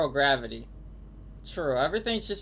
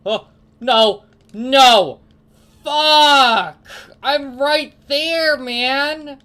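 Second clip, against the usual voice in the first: high, loud frustrated screams; frustrated yelling; 9 semitones higher, 13 dB louder, much slower than usual. Real, not acted. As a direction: scream at the failed jump. Failed jump, gaming frustration.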